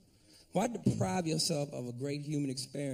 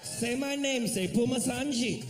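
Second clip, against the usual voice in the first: high-pitched mock Indian accent; domineering lover in exaggerated Indian accent; East Indian accent; 6 semitones higher than usual. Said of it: "is that East Indian accent".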